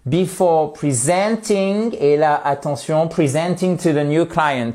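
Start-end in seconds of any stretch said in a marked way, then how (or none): 0.1-2.0 slow, careful English example; teacher reading example aloud; slow model sentence. Shown as a demonstration. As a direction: say it slowly for the class.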